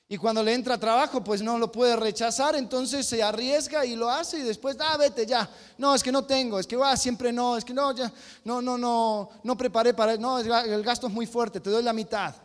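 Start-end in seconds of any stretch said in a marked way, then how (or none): 4.8-12.3 flustered stalling excuses, higher and louder; a boss wriggling out of paying the bricklayer; excuse-making boss. Retold or imitated. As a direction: fumble for reasons not to pay. Boss dodging paying the worker.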